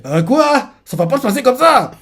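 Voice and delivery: protestation haute et indignée; énervé. Retold or imitated. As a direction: refuser de se laisser faire. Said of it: "lui fait". (Luffy indigné, voix aiguë)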